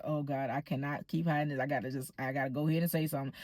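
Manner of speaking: low, hushed thinking to oneself; murmured private panic, kept low; inner thought. Retold or imitated. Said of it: "in my head, I'm like".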